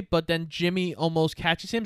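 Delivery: ordinary talk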